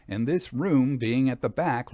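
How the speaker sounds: usual speaking voice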